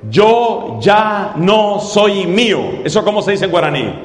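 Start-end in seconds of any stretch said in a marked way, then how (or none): 0.1-2.8 very slow, each word spaced; slow and clear. Shown as a demonstration. Preacher asking Rosa to translate, slow clear phrase. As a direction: spell it out slowly for translation. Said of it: "enséñanos a decir".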